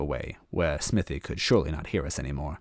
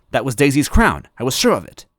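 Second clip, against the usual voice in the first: excited; 7 semitones higher, 7 dB louder than usual. A narrator's reading. Narrator excited recognition.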